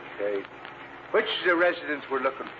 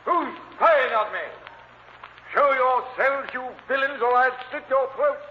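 loud, high threatening roar; menacing shout; 10 semitones higher, 3 dB louder than usual. Acted. Actor [name] roaring threats into the dark garden. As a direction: bellow at the unseen intruders.

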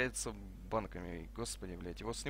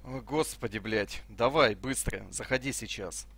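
louder, exasperated push to hurry; impatient urging; 7 dB louder, slower than usual. Real, not acted. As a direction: urge a teammate to get in, loudly. Impatient urging a teammate to join. (раздражённо и громко поторапливает)

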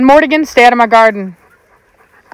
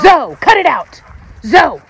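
sharp, higher call to stop; scolding the dog; 6 semitones higher than usual. Real, not acted. Owner scolding puppy Zoe for biting, firm higher call. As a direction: tell the puppy off firmly.

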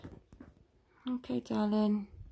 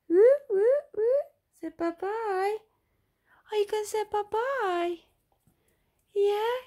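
much higher, louder sing-song coaxing; high baby talk; 12 semitones higher, 7 dB louder, slower than usual. Acted. Mother coaxing her daughter to wave bye-bye, very high baby talk.

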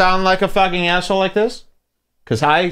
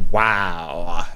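low, soft unimpressed wow; Brandon Wardell; 9 semitones lower, 3 dB quieter than usual. Acted. Comedian unimpressed by the mob's threat, low deadpan. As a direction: drop low and underplay it. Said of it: "Brandon Wardell's like".

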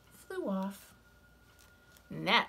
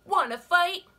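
high, loud, grumpy bug; grouchy ladybug voice; 11 semitones higher, 10 dB louder than usual. Acted. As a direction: bark the challenge up high. Ladybug challenges hyena.

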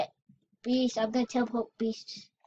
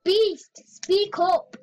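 higher-pitched, raised-voice request; pitched up, insistent; calling out; 6 semitones higher than usual. Real, not acted. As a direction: call to a friend you can't hear. Calling to barely audible friend.

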